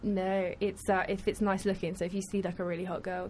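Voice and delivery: usual speaking voice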